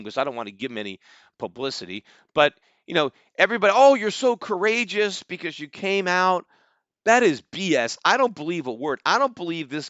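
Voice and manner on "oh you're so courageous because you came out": mocking praise. Mocking fans praising the guy.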